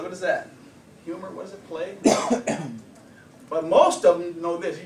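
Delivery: ordinary talk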